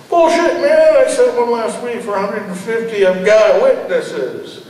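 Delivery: high, loud, protesting voice of the landlord; indignant landlord. Acted. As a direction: push back hard, high and loud. Landlord's lying excuse on phone.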